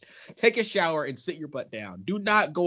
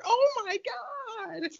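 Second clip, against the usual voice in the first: slow, much higher mock shriek; mock horror; much slower than usual. Host mocking the girlfriend's panic at seeing Todd, high and slow. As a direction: overplay the girl's fright. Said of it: "If it's Todd just standing there".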